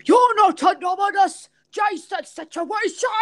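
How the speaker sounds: high, hammed-up Irish accent yell; Jason. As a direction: shout it in a bad Irish impression. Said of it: "I'm doing an impression of you".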